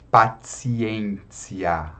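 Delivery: "Paciência" as "pa-tsi-en-tsi-a"; very slow "Paciência", each soft T like TS; softened T. Shown as a demonstration. Teacher modelling Latin, "Paciência" with TS sounds. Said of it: "a gente suaviza o ti".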